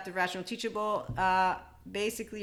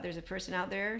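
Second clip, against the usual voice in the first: ordinary talk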